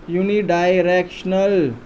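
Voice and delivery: Unidirectional stretched out part by part; "yu-ni-daai-rek-shnal", each part held long; slow, drawn out. Shown as a demonstration. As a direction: spell out unidirectional slowly for a class. Teacher repeats key term slowly.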